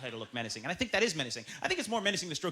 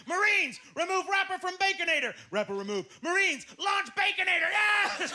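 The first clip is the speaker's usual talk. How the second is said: military order; 12 semitones higher, 3 dB louder, slower than usual. Acted. Military commander shouts orders.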